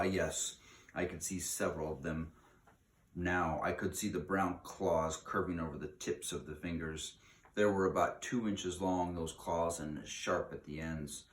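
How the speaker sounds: ordinary delivery, nothing marked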